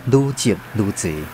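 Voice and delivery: ordinary talk, nothing marked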